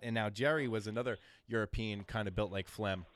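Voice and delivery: ordinary delivery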